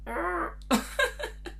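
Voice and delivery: high squeaky giggle-like rabbit sound; high squeaky mock rabbit noise; rabbit noise imitation. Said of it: "I swear it went".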